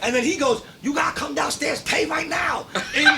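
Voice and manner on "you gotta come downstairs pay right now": high, pushy fake Chinese accent; bad Chinese accent. Imitating the prank caller demanding payment, bad Chinese accent. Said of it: "in the worst Chinese accent you ever heard".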